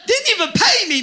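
high, comic protest; mock indignation. Shopper griping about the receipt check, mock indignant. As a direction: protest it high, half laughing.

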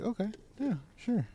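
high, soft acquiescence; meek going along. Acted. Friends shrugging along without questions, meekly agreeing.

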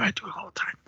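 hushed whisper, voice dropped away; sheepish whispered admission; whispered aside. Real, not acted. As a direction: let the confession slip out under your breath. Guilty confession slipped in aside.

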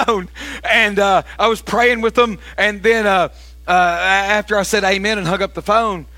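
ordinary delivery

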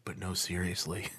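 very low, hushed inner voice; little inner voice. Retold or imitated. Nagging inner voice undercuts the reassurance, hushed and low. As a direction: drop to a soft, ominous undertone. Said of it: "this little voice in my head".

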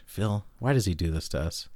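exasperated whispered aside. Real, not acted. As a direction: whisper it to Phil in disbelief.

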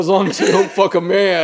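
laughing macho warning; mock macho rule. Continuing the macho joke to his friend, loud mock warning.

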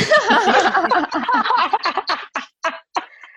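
long high burst of real laughter; bright cackling laugh; big laugh. Real, not acted. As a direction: laugh out loud at yourself. Laughs at her own laziness.

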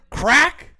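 high loud mock crack of a beer can; sharp imitated can-opening sound. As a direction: pop the word like a can opening.

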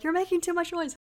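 half-hearted retold yell; mock yelling. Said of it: "he's yelling at the neighbor".

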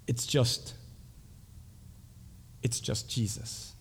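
soft, slow, with long pauses; hushed reveal. Acted. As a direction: let the realization land quietly. Awed reveal after the vision.

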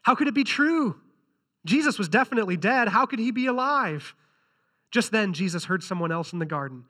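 normal talking voice

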